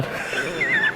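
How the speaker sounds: high squealing laugh bursting out; real laughter, sudden and high. Real, not acted. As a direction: crack up at the funny word. Teacher laughs at student's dialect.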